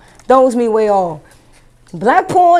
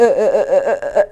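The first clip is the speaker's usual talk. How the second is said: usual speaking voice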